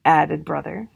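plain reading voice

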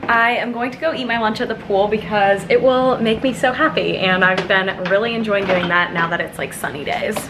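usual speaking voice

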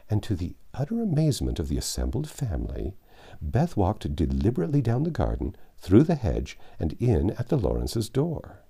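ordinary delivery

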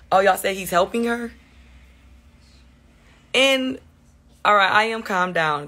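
ordinary delivery

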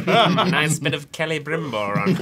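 laughing mock Welsh accent. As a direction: Welsh lilt, half giggling. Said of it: "Is that your Welsh accent?".

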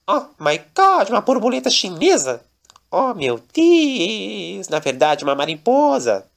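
bem mais agudo e mais alto, exclamando; Daniela espantada. Acted. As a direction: espanto estridente ao ver o bicho. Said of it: "E Daniela". (Daniela espantada com a mariposa, voz aguda e alta)

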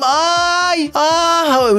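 high mock moaning; mocking groan. Teammates taunting the faking player, high mock groans. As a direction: jeer like teammates mocking a faker.

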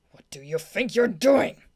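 slow, loud, put-on defiant boy voice; loud defiant character voice; resisting boy. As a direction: snap back at your rescuer, drawn out. Boy resists being carried off.

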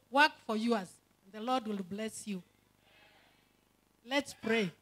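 usual speaking voice

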